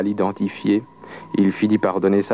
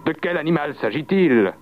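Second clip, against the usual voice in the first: higher-pitched, lightly triumphant challenge; triumphant question; 6 semitones higher than usual. A narrator's reading. The devil to the peasant in the oat field, triumphant. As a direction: pose it with a touch of triumph. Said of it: "avec un air de triomphe".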